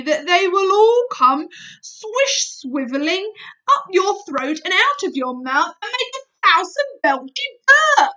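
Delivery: gleeful big friendly giant. Acted. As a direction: build up to the burp, gleeful. BFG explains burps gleefully.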